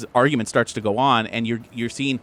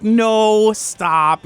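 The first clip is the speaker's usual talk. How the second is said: drawn-out, higher playful "no"; giggly-girl protest, loud at first; 9 semitones higher, 6 dB louder than usual. Acted. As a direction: tease with a long protesting no. Playful puppy love, acted girl.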